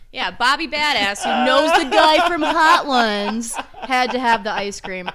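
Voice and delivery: slow, booming jeer over laughter; loud mockery. Real, not acted. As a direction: jeer loudly at a failed tough guy. Mocking the boastful customer.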